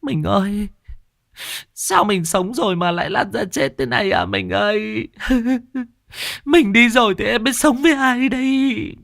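very high keening lament; wailing widow. Acted. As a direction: sob and wail over your dead husband. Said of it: "người đàn bà ... đang khóc lóc".

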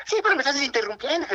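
high-pitched imitation of scolding secretary; mock angry shriek. Secretary scolding an interrupting visitor, mock shriek. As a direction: snap back way up high, as the offended secretary. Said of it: "qué gritó esa señora".

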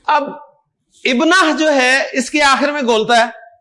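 ordinary talk

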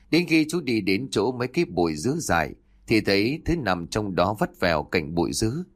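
ordinary delivery

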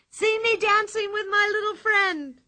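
high excited calling out; Pippi, gleeful cry. A narrator's reading. Pippi riding the whirling bull, crying out in glee. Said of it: "cried Pippi".

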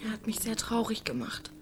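barely voiced, close to tears; sad whisper. [name] whispers her hurt to Pete, sad whisper.

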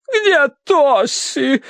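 high, drawn-out martyred wail; long-suffering. A narrator's reading. Mother asks after her missing daughter, slow high suffering. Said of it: "страдальчески закатила глаза".

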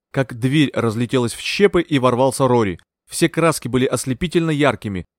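usual speaking voice

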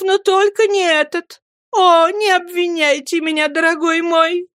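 high-pitched woman's voice, protesting imploringly; hurt, beseeching wife; distressed pleading mother. Acted. Mother pleads with her husband.

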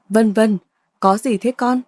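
usual speaking voice

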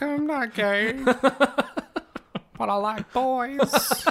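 high, drawn-out creepy voice; creepy mock voice. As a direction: stretch it out high and slow, creepily. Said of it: "Just really creepy".

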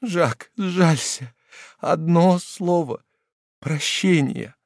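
pleading, high and slow; imploring tone. Acted. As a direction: beg him for one word. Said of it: "умоляющим тоном".

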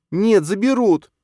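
put-on high, loud, slow child's certainty; a boy sure of the danger; boy insisting to mother, certain. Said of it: "уверенности в голосе Неда".

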